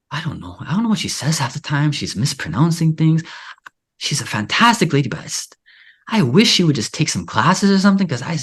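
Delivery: a mildly exasperated mock complaint; mother's complaint. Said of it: "my mom always complains to me".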